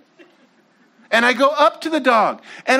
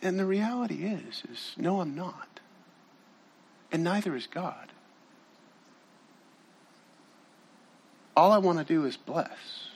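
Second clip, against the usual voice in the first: hushed and sincere; 5 semitones lower, 10 dB quieter than usual. Real, not acted. Tender turn in the sermon.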